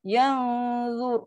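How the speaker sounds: "Yang" held on the hum, only then "zur"; settled dengung. Shown as a demonstration. Teacher shows settling before za, held dengung. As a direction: finish humming "Yang" before releasing a heavy "zur". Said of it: "Settle dengung, baru kena huruf za".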